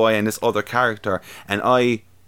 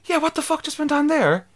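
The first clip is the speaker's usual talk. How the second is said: much higher, baffled rant; incredulous stranger. Retold or imitated. Stranger asking him about the movie, high and incredulous. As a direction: mimic the stunned guy in the row ahead. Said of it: "he was like".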